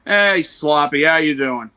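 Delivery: loud Southern drawl hello; Southern accent greeting. Retold or imitated. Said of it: "try and sound as Southern".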